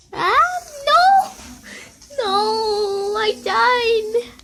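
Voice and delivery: very high, loud cry of no; anguished scream. Real, not acted. Kid dying in the game, high loud scream. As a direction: scream as you lose.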